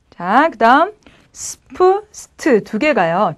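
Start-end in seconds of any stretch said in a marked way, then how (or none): 1.3-2.6 스프스트 with a hissed S before P and T; plain S clusters. Shown as a demonstration. Teacher gives the mid-word sound, plain S. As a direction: keep S as S in 스프스트. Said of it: "중간에 있으면 그냥 스프스트인데".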